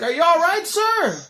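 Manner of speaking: very high, loud shouted courtesy; hammed-up concern at full volume; calling after someone. Storyteller replays calling after the departing man, shouting.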